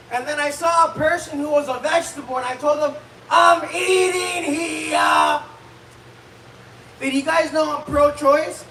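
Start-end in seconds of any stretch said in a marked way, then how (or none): 3.3-5.4 high, very drawn-out indignant yell; brash mock shout. Comic to a paraplegic, brash indignant shout. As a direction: holler it, stretching every word. Same catchphrase at vegetable patient.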